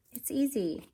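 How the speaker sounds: ordinary delivery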